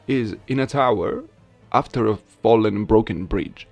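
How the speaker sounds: usual speaking voice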